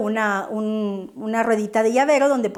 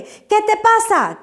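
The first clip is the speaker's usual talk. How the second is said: higher, louder sing-song call; playful teacher call; 9 semitones higher, 4 dB louder than usual. Shown as a demonstration. Teacher calling to her class, playful and higher. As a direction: call out to grab the class's attention. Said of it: "tenemos aquello de".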